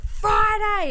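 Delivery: very high, loud imitated scream; mock yelling. Host imitates Brock yelling while swinging a chair, mock yell. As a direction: yell it drawn out, hamming up the wrestler. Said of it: "he starts yelling".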